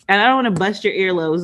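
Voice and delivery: usual speaking voice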